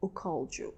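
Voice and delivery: "who" said with a weak, dropped H. Shown as a demonstration. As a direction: let "who" blur into "called" without the H. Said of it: "We don't say who".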